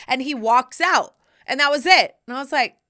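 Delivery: usual speaking voice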